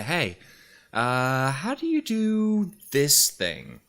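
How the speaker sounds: high, slow questioning voice; curious viewer asking. Retold or imitated. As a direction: ask it like an eager viewer. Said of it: "somebody will say".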